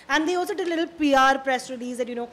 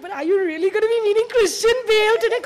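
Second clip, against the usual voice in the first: giggly excitement; 7 semitones higher, 8 dB louder than usual. Retold or imitated. Retelling the giggling female officers.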